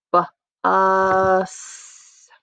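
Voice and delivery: "B, us" split after the B, vowel stretched; segmented word. Shown as a demonstration. Teacher showing three sounds in bus, segmented word. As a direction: sound out "B, us" slowly with a gap after B. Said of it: "I hear three sounds in the word bus".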